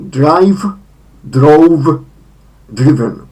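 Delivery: drive, drove, driven said loud and slow; English verb forms. Shown as a demonstration. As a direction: spell out each form by ear. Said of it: "w wymowie". (głośno wypowiedziane drive, drove, driven)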